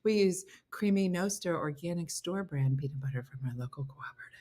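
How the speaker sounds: hushed, near-whispered mimicry; mock bougie. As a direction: repeat it under your breath, mocking. Mock repeat of bougie comment.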